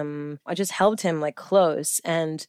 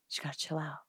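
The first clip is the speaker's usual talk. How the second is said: much softer, understated advice; imitating calm doctor; 16 dB quieter, faster than usual. Doctor telling impatient patient to relax, quiet mock reassurance. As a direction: quietly talk someone down. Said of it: "he's just like".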